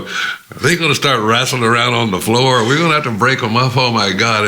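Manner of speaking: high, loud, hurried fretting; mock alarm. Two colleagues eyeing each other before a fight, acted alarm.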